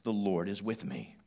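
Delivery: soft, settled affirmation; soft declaration. Shown as a demonstration. Preacher modelling a phrase for the hurting, softly. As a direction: say it quietly to yourself. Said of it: "a good phrase to say to yourself".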